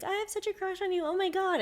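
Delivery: high-pitched giddy crush gush, imitated. Woman mimicking her ex gushing on FaceTime, giddy. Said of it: "when you would get giddy".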